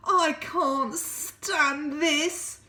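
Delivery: very slow, high and loud complaint; exasperated Mister Bear. Acted. Mister Bear kept awake, slow high exasperated wail. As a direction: drag every word out in despair. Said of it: "said Mister Bear".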